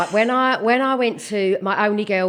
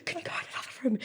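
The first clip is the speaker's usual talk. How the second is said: hushed whisper; whispered aside; 15 dB quieter, much faster than usual. Retold or imitated. Mum whispering to her son in front of friends. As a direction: breathe the words without voice. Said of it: "I'd go to him".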